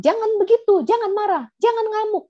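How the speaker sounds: put-on high, sharp scolding of a child; a parent snapping at a tantrum. Parent scolding a raging child, high and sharp. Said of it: "Kan biasanya kita bilang".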